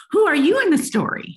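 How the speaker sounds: put-on high, loud child's question; curious child. Child turning the question on parent, high and eager. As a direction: ask it like an eager little kid. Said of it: "might say to the parent".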